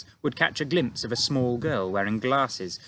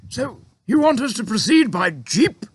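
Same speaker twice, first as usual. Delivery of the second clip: high, loud gruff officer; army chief grumbling to the giant, loud; blustering army chief; 12 semitones higher, 7 dB louder than usual. A narrator's reading.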